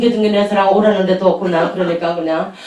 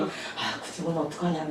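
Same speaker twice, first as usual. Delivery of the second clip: soft, half-whispered worry; a quiet fretting aside; hushed worry; 7 semitones lower, 18 dB quieter than usual. Retold or imitated. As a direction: murmur it so no one hears. Quiet worried aside to colleague.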